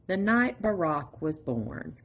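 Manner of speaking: ordinary delivery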